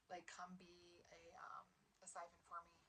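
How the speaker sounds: a retold villain's whisper. Villain's request, slow.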